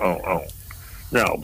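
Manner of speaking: ordinary talk, nothing marked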